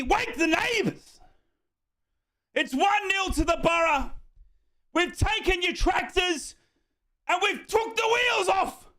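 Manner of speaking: high, drawn-out triumphant call; jubilant shouting. Real, not acted. Fan taunting Ipswich after scoring, jubilant high shouting. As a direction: crow about the goal in long high phrases. Crowing over the goal just scored.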